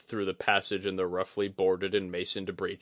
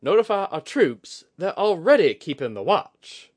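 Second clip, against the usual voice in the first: high, loud incredulous question; much higher, loud sceptical retort; dubious; 10 semitones higher, 9 dB louder than usual. A narrator's reading. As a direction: question his words in disbelief. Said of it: "shot Oguri a dubious look".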